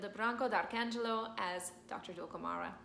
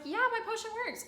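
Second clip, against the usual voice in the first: very high, smug cackle of a voice; high gleeful doctor; 13 semitones higher, 4 dB louder than usual. Acted. Dulcamara taking credit, very high gloating voice. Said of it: "Dulcamara's like".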